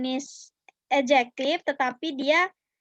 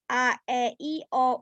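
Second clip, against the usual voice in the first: usual speaking voice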